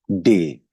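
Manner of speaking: डे said as day; name the letter डे, German style. Shown as a demonstration. Teacher names German D, day sound. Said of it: "डी को German language में हम pronounce करते हैं".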